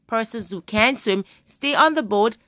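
ordinary delivery

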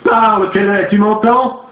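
high, insistent demand; furious command. A narrator's reading. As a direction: order the spirit to answer.

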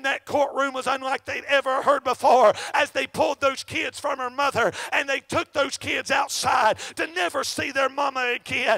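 loud, fervent, shouted sermon cadence; full-force preaching. Acted. Preacher calling the congregation to faith, shouting fervently. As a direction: hammer each line home to the congregation. Preacher urging listeners toward salvation.

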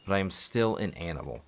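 narrating a tragic Russian novel; story narration. Acted. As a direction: read the novel aloud like an audiobook. Reads a book aloud.

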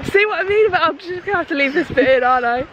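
high-pitched, just recovering from a laugh; amused, giggly. Real, not acted. Host admits the blooper stays in, giggly and amused. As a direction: talk through the tail end of giggles. Still amused after cracking up.

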